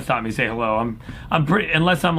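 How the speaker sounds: ordinary delivery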